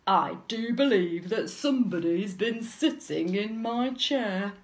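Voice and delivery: loud, growly big bear; indignant booming bear; Daddy Bear. A narrator's reading. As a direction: growl out the accusation loudly. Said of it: "growled Daddy Bear".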